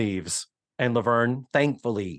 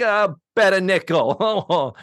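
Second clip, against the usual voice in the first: slow, a little louder hesitant offer; mock Laverne offering a nickel; hesitant bet; 4 semitones higher, 4 dB louder, slower than usual. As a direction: drag out the bet like unsure. Laverne voiced in retelling.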